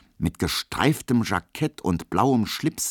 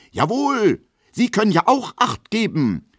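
viel höher und lauter, empört gerufen; männliche Entrüstung; 6 dB louder than usual. A narrator's reading. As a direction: sich entrüstet vor seine Dame stellen. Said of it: "ruft er in männlicher Entrüstung". (Emil verteidigt Emma empört gegen Schmelow)

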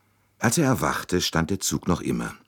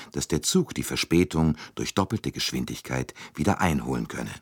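usual speaking voice

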